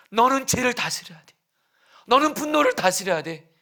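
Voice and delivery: urgent plea building into high, loud cries; earnest, anguished pleading. Acted. God warning Cain about the crouching devil, anguished pleading. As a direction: plead desperately, rising to a sustained high shout. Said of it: "간절하고 안타깝게 말씀하시는 거예요".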